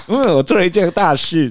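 high, loud proud little voice; smug toddler. Retold or imitated. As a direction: voice the kid, pleased with himself. Voicing toddler's smug pride. (高声模仿小孩得意)

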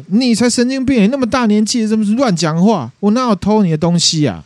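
put-on angry young man. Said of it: "这个年轻人呢急了".

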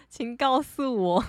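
slow, laughing request to listeners; laughing plea. Real, not acted. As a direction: laugh while asking the audience to tell you. (笑着慢慢地请听众告诉我)

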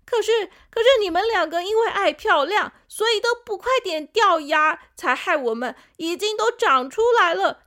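put-on high, pushy kid voice blaming big brothers; complaining little tooth. New tooth nags older brothers, high whiny kid voice. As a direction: whine at the baby teeth to fall out.

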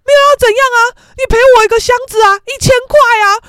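high, loud insistent mother; mock haggling shout; imitated angry demand. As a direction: demand compensation at full volume. Mother demanding payment in quarrel.

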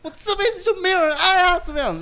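hammed-up despair, cracking up; laughing hard. Real, not acted. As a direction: laugh through a mock wail. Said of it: "我就哭到不行".

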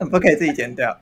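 ordinary talk, nothing marked

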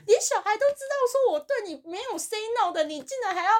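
high, loud mock-exasperated boss voice. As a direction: squeal it like the boss can't believe this. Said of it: "我老板就整个在那边说".

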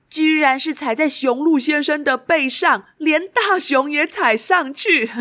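put-on high, bright storytelling for kids; reading to a toddler. A narrator's reading. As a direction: tell it to a little child, with delight. Narrating brightly to children.

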